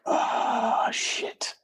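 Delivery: breathy whispered "Oh, shit" under the breath; whispered dismay. Retold or imitated. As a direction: let it slip out as a stunned whisper. Reacting to premature baby news.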